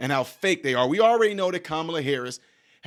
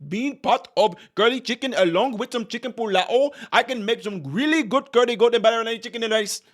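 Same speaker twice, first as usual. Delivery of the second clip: put-on lightly done Indian accent, a bit higher; hint of an Indian accent boasting about curry; playful imitation of an Indian accent bragging about cooking; mock Indian accent; 6 semitones higher than usual. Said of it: "when she get around her Indian folks".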